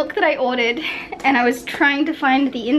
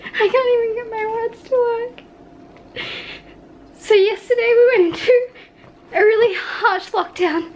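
real tearful struggle to speak; crying; 9 semitones higher than usual. Real, not acted. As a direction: voice breaking up high through tears. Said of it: "I know exactly why I'm so emotional".

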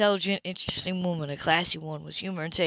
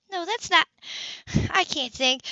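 high, breaking protest; flustered and overwhelmed by pain; distressed; 10 semitones higher than usual. A narrator's reading. Woman in pain denying his accusation, flustered. Sybil faltering under migraine pain.

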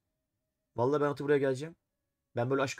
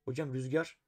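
normal talking voice

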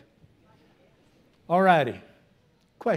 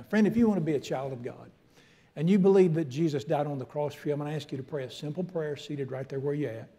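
ordinary delivery

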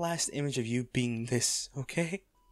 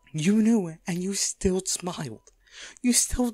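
louder, higher pained cry; anguished outburst; 5 semitones higher, 4 dB louder, slower than usual. Acted. Accusing the sleeping bodyguard, an anguished raised cry. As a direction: let the hurt break out loud.

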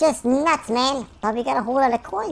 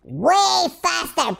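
usual speaking voice

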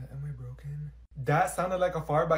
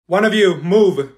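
loud order; 9 dB louder, slower than usual. Acted. Teacher ordering students.

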